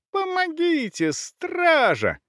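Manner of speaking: very high, piercing woman's cry; squealing for help. A narrator's reading. Woman being robbed calls the guard, squealing. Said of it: "И снова с визгом".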